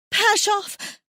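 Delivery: high, loud panicked call of his name; terrified cry. A narrator's reading. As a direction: shake him and scream his name.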